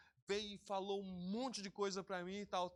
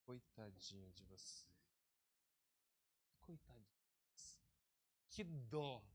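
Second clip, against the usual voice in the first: mock pity; 8 semitones lower, 9 dB quieter than usual. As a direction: coo "Coitadinha" with ironic pity. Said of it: "eu falo assim".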